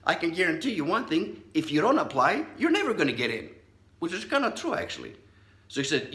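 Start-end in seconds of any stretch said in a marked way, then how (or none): 0.1-3.5 higher, louder indignant uncle. Retold or imitated. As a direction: brush the excuse aside, outraged. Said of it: "He goes".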